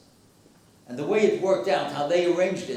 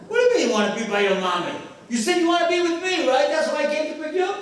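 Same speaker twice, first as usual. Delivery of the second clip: fast, rising protest; incredulous, higher-pitched retort; exasperated father; 9 semitones higher, faster than usual. Retold or imitated. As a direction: imitate a baffled dad arguing with his child. Exasperated father voiced in story.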